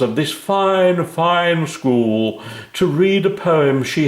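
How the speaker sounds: a lightly voiced grand Lord Mayor; pompous mayor. A narrator's reading. Lord Mayor announces a poet.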